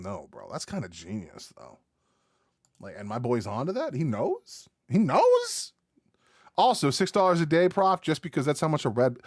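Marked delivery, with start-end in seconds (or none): 4.9-5.7 high incredulous squeak; shocked, unable to believe it; disbelieving high question. Real, not acted.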